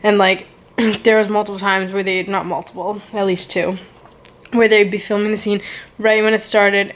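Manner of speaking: ordinary talk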